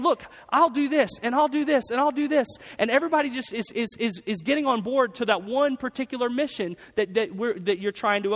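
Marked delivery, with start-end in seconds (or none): 0.0-2.5 higher, louder, rallying crew voices; eager volunteering. Acted. Battleship crew each claiming a task, eagerly. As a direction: pipe up like crew jumping to help.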